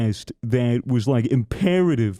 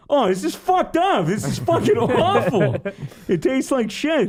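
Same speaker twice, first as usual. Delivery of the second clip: high mock-disgusted yelp, laughing through it; disgusted friend; 11 semitones higher than usual. Friend on Skype gagging at mustard crackers, high and disgusted. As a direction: squeal it like the cracker is vile.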